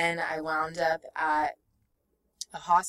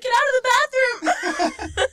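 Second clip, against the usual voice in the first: high, loud, laughing shout; hammed-up holler breaking into laughter; mock yell; 8 dB louder than usual. Joking about others chasing her out, mock yell. Jokingly voices people yelling.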